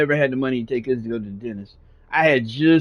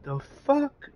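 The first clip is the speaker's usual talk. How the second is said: shocked; 12 semitones higher, 8 dB quieter than usual. Retold or imitated. Reacting to losing teeth.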